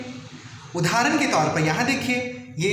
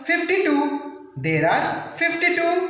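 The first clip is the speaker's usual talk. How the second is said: put-on slow, raised "There are fifty-two" spelled out for kids; slow teaching repeat; 6 semitones higher than usual. Shown as a demonstration. Teacher to children, slow clear number. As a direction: drill the number slowly for the class. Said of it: "होती है पूरी तरह से बावन".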